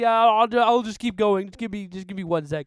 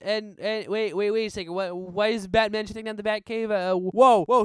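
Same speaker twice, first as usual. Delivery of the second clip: ordinary delivery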